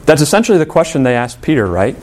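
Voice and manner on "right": ordinary talk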